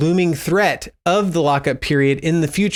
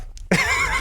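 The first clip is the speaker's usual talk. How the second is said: nervous laugh; 4 dB quieter than usual. Real, not acted. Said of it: "you can see that I'm very unsure".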